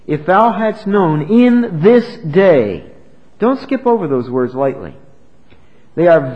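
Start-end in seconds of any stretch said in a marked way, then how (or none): 0.0-2.9 "in this day" drawn out slow and louder; stress on this day. Shown as a demonstration. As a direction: lean hard on "in this day", slowly. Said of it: "Do you see those words, "in this day"?".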